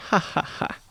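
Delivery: forced airy laugh; breathy faked "ha ha ha". A narrator's reading. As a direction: fake a laugh under your breath. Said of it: "as if she was joking".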